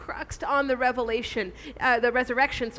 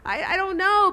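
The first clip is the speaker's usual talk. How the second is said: unsure doubter; 6 semitones higher than usual. Retold or imitated. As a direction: voice a listener unsure of their faith. Voicing a doubtful listener.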